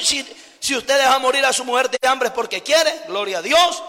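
ordinary talk, nothing marked

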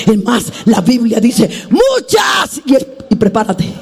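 high, shouted, half-sung preaching; preaching at full force. Acted. Preacher shouting comfort to the congregation, half-singing.

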